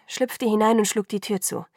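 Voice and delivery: plain reading voice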